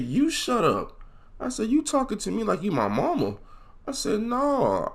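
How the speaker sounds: high-pitched, indignant backtalk; defiant retort. Retold or imitated. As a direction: act out sassing the teacher, voice climbing high. Student talks back to the teacher.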